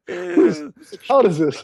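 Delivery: high, incredulous double-take; mock disbelief. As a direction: play it like you can't believe your eyes. Mock confused disbelief at sales.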